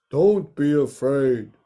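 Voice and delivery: low and slow, a god's calm reassurance; deep voice. Shown as a demonstration. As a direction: drop the voice low and draw it out. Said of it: "in a deep voice".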